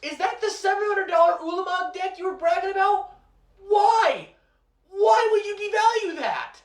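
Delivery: very high, loud horrified yell; outraged shouting. Acted. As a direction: scream at the wasted deck. Friend ruining expensive deck.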